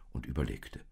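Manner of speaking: ordinary delivery, nothing marked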